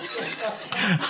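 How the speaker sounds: soft breathy chuckle; a quiet delighted laugh; amused laugh. Real, not acted. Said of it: "I just love the way it's translated".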